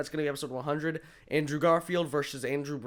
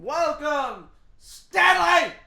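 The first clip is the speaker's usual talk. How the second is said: very high shouted meme quote; meme character voice; 8 dB louder, much slower than usual. Acted. Co-host imitating a Ryan meme, high shout. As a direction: belt it out loud, drawn-out and high-pitched. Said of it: "that was really good for my voice".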